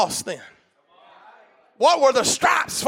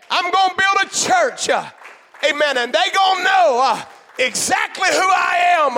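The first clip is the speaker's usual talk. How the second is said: full-force pulpit cry; preaching climax; 6 semitones higher than usual. Real, not acted. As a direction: lift it up and shout it to the church.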